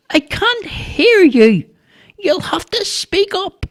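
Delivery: put-on slow, high-pitched old woman calling back; hard-of-hearing neighbour. A narrator's reading. Deaf neighbour answering the boy's challenge, slow calling. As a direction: call out slowly in a high voice, straining to hear.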